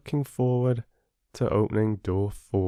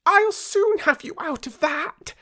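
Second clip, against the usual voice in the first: very high squeaky little mouse voice; a tiny mouse piping up to reassure. Acted. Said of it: "said Mouse".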